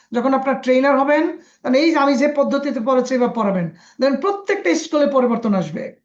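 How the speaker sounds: ordinary talk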